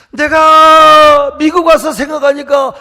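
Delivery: a loud, slow, drawn-out cry; loud slow exclaim. Retold or imitated. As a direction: belt it out slowly, half-sung. Retold wife, loud slow exclaim. (크고 느리게 외치듯)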